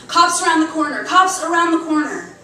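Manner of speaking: loud, high urgent call; calling out a hurried alarm; urgent warning. Acted. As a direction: warn the group the cops are close. Warning friends of police nearby.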